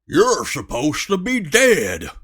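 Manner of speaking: loud gruff dwarf voice; accusing her for surviving. A narrator's reading. Dwarf scolding the revived girl, gruff dwarf voice. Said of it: "demanded Stumpy".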